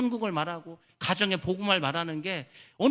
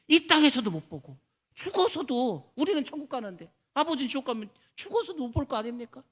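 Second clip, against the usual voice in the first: impassioned preaching; 7 semitones higher, 4 dB louder than usual. Acted. Preacher lamenting to the congregation, high and loud. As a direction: cry out the girl's grief from the pulpit.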